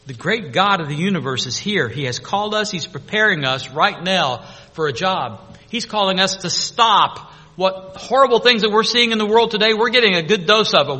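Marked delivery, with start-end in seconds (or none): 5.7-11.0 loud, raised preacher's voice; forceful preaching. Real, not acted. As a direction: urge the congregation on with force.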